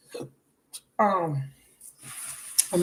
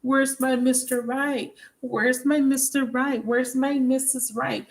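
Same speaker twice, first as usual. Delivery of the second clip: higher, slow, longing plea to the universe; pleading mock wish; 6 semitones higher, slower than usual. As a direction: mimic someone begging the universe for love. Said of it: "you keep telling the universe".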